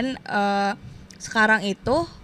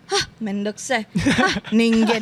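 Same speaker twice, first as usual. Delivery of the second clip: mock weeb anime voice; much slower than usual. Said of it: "kayak gitu-gitu".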